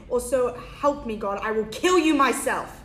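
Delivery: angry warning swelling to a shout; furious threat. Acted. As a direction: threaten your child, rising in volume. Mother threatens son after hitting him.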